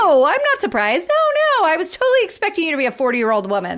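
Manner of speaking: high, squeaky no, then a fast rushed cover; panicking but pretending to be cool; a teen caught out, voice cracking upward then rushing; flustered denial. [name] flustered, denying surprise.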